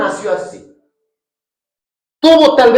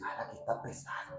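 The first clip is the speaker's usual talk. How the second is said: hushed uneasy whisper; 10 semitones lower, 25 dB quieter, faster than usual. Retold or imitated. A visitor sensing a heavy place, whispering. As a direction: breathe it like you sense evil. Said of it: "tú dices".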